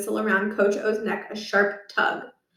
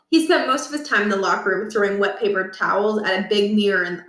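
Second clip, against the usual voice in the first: ordinary delivery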